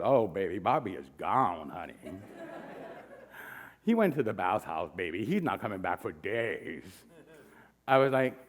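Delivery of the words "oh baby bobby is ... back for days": Ron's voice. Retold or imitated. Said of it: "Ron looks at me and goes".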